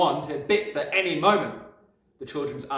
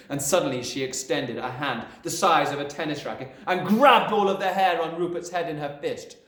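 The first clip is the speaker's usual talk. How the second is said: plain reading voice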